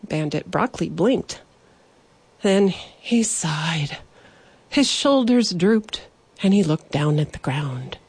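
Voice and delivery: plain reading voice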